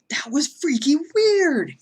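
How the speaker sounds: high, loud, slow amazement; stunned. Real, not acted. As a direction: drag out the disbelief. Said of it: "I yelled".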